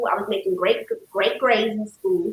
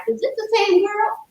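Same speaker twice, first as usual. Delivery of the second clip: amazed hometown folks; 11 semitones higher than usual. Retold or imitated. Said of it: "my people back in Lubbock was like".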